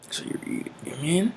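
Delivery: quick high whisper. Real, not acted. Preacher whispering to his listeners, quick and high. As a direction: lean in and whisper it. Hushed aside.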